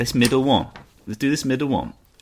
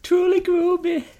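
much higher, squeaky repeat; squeaky mock voice; 6 dB louder, slower than usual. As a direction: squeak it out mockingly. Mocking the misread word.

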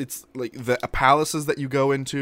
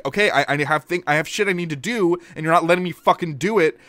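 frustrated; 7 semitones higher, 4 dB louder, faster than usual. Retold or imitated. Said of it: "you're like".

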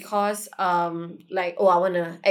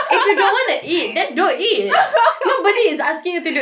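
ordinary talk

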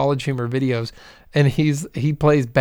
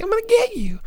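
high, squeaky villain threat; mock big man voice. Mocking a big actor's silly Batman voice, high squeaky. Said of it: "eight-foot-tall man, big and buff".

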